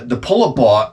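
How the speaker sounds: ordinary talk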